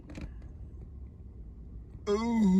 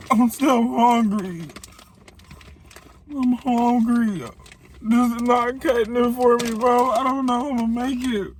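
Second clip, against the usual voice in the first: whiny complaint; 9 semitones higher than usual. Real, not acted. Complaining about hunger.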